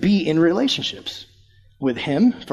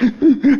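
quick gasping mock sobs; imitated hiccuping sobs; 8 semitones higher, 4 dB louder than usual. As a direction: imitate a little girl's hitching cry. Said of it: "And in between her, like".

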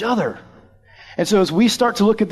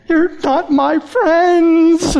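high, loud, drawn-out mock wail; imitated bawling child; 12 semitones higher, 5 dB louder, much slower than usual. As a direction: wail it like a crying little girl. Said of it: "Just like that".

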